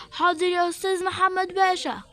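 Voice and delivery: usual speaking voice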